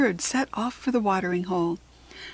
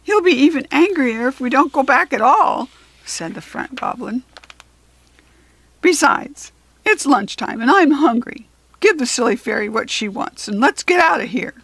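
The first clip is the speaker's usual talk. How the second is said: grumpy goblin; 4 semitones higher, 7 dB louder than usual. A narrator's reading. Front goblin grumbling.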